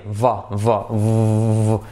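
"v" buzzed like a voiced F. Shown as a demonstration. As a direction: buzz the "v" on the lip. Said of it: "Como una F sonora". ("v" vibrante, como F sonora)

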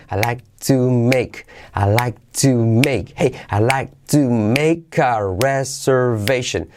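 drawn-out words for repeating; slow model. Shown as a demonstration. Teacher leading repetition, slow model. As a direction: build the phrase slowly, word by word.

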